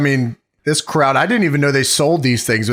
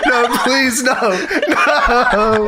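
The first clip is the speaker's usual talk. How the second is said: higher, laughing plea; mock pleading; 7 semitones higher, much slower than usual. Co-host voicing man caught on toilet, mock pleading. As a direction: beg in hammed-up embarrassment.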